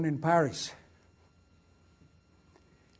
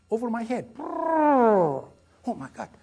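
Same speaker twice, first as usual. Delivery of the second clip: higher, louder, very slow exclamation; reliving the shock; 6 semitones higher, 5 dB louder, slower than usual. Retold or imitated. Pilot retells German gunfire over his head, stunned and drawn out. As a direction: relive the fright as the shots fly past.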